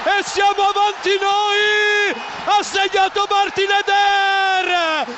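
screaming goal call, high and slow; breathless Italian goal commentary. Real, not acted. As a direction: yell the goal with everything.

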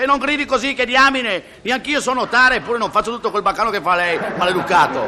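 scolding. Acted. Man on shore scolds shouting man.

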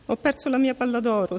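princess sobbing high over her lost ball. A narrator's reading. Princess sobs to the frog, mildly tearful. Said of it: "singhiozzò".

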